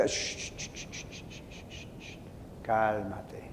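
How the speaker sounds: string of soft shushes fading into a low calm word; very soft, low soothing; repeated shushing. Retold or imitated. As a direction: patiently hush him until he stops. God shushing the protests.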